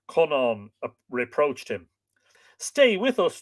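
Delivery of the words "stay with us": high-pitched plea; pleading, voice lifted high; begging. A narrator's reading. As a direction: beg him to stay. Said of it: "Caillte begged".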